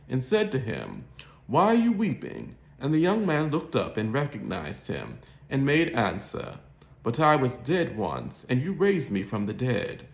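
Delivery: ordinary delivery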